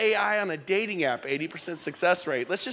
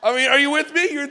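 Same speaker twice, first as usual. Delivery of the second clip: usual speaking voice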